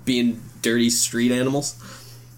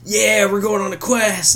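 mock heroic, higher and slow; eager hero impression; 9 semitones higher, slower than usual. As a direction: play the keen adventurer off on a quest. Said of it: "Chris Pratt's like the, like".

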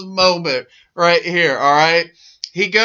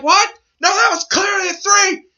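high shouted insult at the ref; mock angry yell; 10 semitones higher, faster than usual. Wrestler yelling at the referee after the review, mock angry. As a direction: berate the referee in a hammed-up yell.

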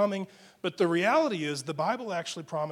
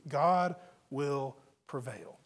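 slow and low, each word set apart; slow, weighty declaration; 3 semitones lower, slower than usual. Real, not acted. Preacher giving the truth to believers, slow and weighty. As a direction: let each word land separately.

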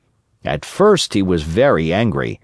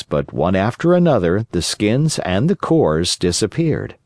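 usual speaking voice

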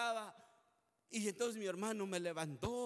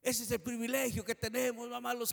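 louder, fervent preaching cadence; 2 semitones higher, 6 dB louder than usual. Acted. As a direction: drive it home to the congregation. Preaching at full force.

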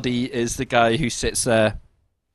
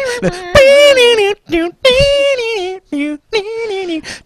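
crying laugh; 7 dB louder than usual. Real, not acted.

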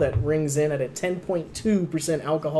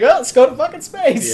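mock exasperated; 9 semitones higher, 6 dB louder than usual. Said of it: "it's just like".

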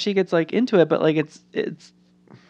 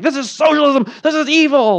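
raised, indignant mock politician; mock Republican outrage; 8 semitones higher, 4 dB louder than usual. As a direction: ham up a fired-up Republican crying socialism. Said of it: "they're like".

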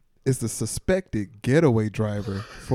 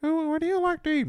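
put-on dotty absent-minded old lady; faster than usual. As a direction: play the senile grandmother. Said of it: "They go with the senile route".

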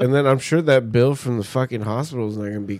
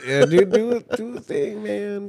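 mock pleading; 9 semitones higher than usual. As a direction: beg playfully in a raised, small voice. Mock begging listeners for reviews.

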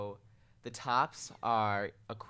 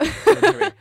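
squealing laugh; 17 dB louder than usual. Real, not acted.

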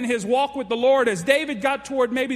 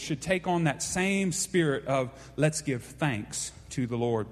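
ordinary talk

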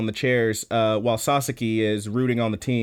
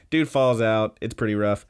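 ordinary talk, nothing marked

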